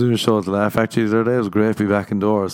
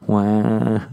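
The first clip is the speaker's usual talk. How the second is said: short mock wail, wah; hammed-up fake crying wail; a lazy pretend sob; fake baby wail. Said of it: "I'll just fake cry".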